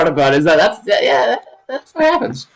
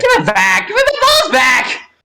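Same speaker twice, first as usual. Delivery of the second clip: whiny shout; slower than usual. Pretend. Demanding his balls back.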